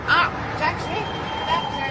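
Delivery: high hailing shout down the street; calling a taxi. Acted. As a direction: wave down a cab, voice thrown high.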